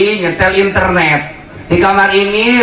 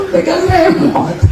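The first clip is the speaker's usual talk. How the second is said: much higher, slow teasing line; mocking high punchline; 13 semitones higher than usual. Preacher mocking people holding the remote.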